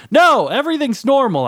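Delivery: high, panicky denial; screamed. A narrator's reading. Son to his father about the hole, screamed denial. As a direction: blurt it in a high yell. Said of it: "I screamed".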